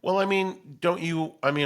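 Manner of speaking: usual speaking voice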